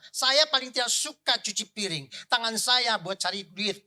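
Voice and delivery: loud, emphatic imitation of a stubborn husband; booming mock indignation; proud refusal. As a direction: declare it as a proud man would. Voices proud husband refusing dishwashing.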